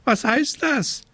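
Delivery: high squawked question; croaking raven. A narrator's reading. Raven demanding an explanation from the witch, croaking. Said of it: "krächzte Abraxas".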